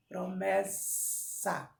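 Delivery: "promessa" stretched slowly, the ss drawn out as a long snake hiss. Shown as a demonstration. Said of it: "olha o sonzinho da cobra no final".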